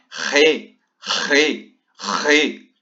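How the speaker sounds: re re re from the back of the throat; Spanish jota sound, strong; throaty Spanish R. Shown as a demonstration. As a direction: rasp the R hard in the throat. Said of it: "com aquele R da garganta".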